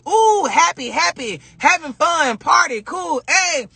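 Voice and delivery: high, bubbly party voice; mock party cheer; excited party happy. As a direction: bounce it up like a party. Imitating party happiness.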